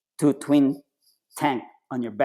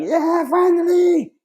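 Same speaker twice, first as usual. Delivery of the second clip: much higher, slow, drawn-out cheer; cheering yell; 6 dB louder, much slower than usual. Retold or imitated. Everyone at the pool cheering as he finally passes, slow high yell. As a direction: stretch it out like a crowd cheering. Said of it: "Everyone was yelling".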